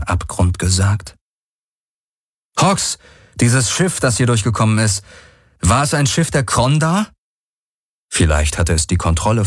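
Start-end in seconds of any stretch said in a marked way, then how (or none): 2.6-7.1 anxious question. Acted. Galen questions Hox anxiously.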